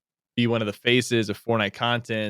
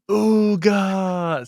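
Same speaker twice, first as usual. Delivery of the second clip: high, loud drawn-out yell; imitating someone yelling out a name; Courage; 10 semitones higher, 6 dB louder than usual. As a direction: holler it like a long stretched battle cry. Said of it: "yelling out his name".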